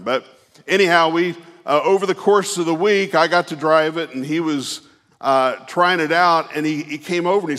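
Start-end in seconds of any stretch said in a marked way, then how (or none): none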